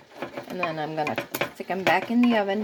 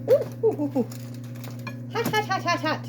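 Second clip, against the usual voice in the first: high ooh-ing around a hot bite; mouth full of hot food; 9 semitones higher than usual. Real, not acted. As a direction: gasp through a scalding mouthful. Tasting freshly fried hot fries.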